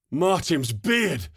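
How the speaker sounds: high, slow startled exclamation; breathless shout. Acted. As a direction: blurt it out, awed and winded. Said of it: "Mirante shouted breathlessly on comms".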